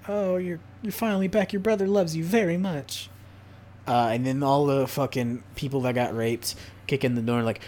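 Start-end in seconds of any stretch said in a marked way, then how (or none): 0.1-3.1 higher, gentle friend voice; comforting friend. Retold or imitated. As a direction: coo it to a recovering sister. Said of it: "It's like".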